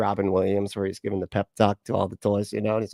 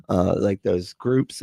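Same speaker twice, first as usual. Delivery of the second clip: ordinary delivery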